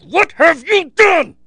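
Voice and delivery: hammed-up despair; mock anguished cry. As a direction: wail it at the coach like a betrayed fan. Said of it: "I was so mad".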